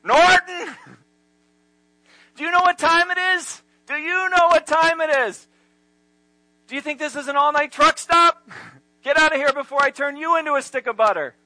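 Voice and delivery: furious high-pitched yelling; rudely awakened. Retold or imitated. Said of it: "Ralph instantly has steam coming out of his ears".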